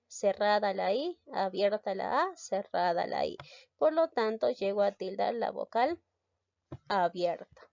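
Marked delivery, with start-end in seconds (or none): none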